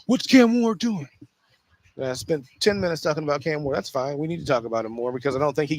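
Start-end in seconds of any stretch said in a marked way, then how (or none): 0.0-1.1 higher, louder, slowed mock outrage; mock outraged pundit. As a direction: ham up a media pundit's outrage at Cam Ward. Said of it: "the crazy rhetoric".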